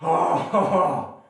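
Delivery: loud pained groans during a stretch; straining in pain. Real, not acted. Painful stretch during workout.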